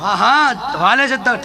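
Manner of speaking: ordinary talk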